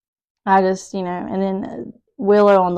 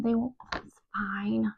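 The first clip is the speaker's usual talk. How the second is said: whispered aside; 9 dB quieter than usual. Real, not acted. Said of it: "cooking aside".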